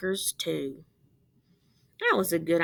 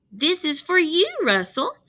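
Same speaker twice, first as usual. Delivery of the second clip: put-on sweet girl voice; 9 semitones higher, 4 dB louder than usual. Jamaica offers her marker.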